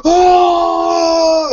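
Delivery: hammed-up old lady yelp of shock; shriek. Old woman smelling eucalyptus, mock shrieked yelp. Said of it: "And she's like".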